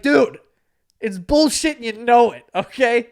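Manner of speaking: loud, high-pitched protest; retold indignant outburst; exasperated. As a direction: call out your friend's lie, worked up. Retold argument with lying friend.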